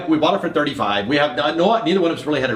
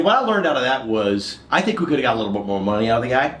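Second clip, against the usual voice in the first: normal talking voice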